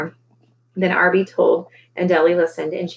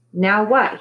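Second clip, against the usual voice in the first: usual speaking voice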